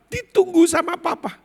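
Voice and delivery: high, loud announcement; urgent insistence. Retold or imitated. Employee telling the son his father waits, urgent. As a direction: urge it loudly at high pitch.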